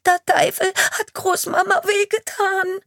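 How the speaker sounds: put-on high, loud, sobbed-out child's voice; sobbing child. A frightened girl telling the police chief through sobs what happened. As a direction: force the words out between sobs. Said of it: "stößt das Mädchen schluchzend aus".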